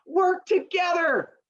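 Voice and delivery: very high slow squeaky agreement; mock cheering crowd. Townspeople agreeing with Aesop, high slow squeaky chant. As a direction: chant it back like eager pupils. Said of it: "And they go".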